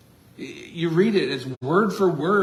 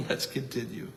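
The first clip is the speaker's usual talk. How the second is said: soft laugh; 3 semitones lower, 8 dB quieter than usual. Shown as a demonstration.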